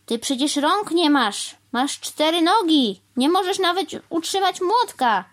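high, loud protest climbing line by line; exclaimed objection. A narrator's reading. Crow listing why a cow cannot hammer, mounting exasperation. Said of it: "zawołał pan Wrona".

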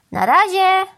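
loud, high cheery-crow farewell call. A narrator's reading. Crow leaves the cow to fetch the fan, calling out. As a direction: call out as you take off. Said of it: "zawołał pan Wrona".

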